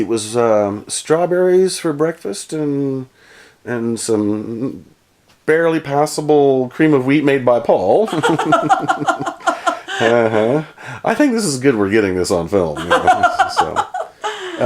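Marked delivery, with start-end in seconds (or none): 5.4-14.6 laughing through the words. Real, not acted. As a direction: giggle while you say it. Laughing about Paul's cream of wheat.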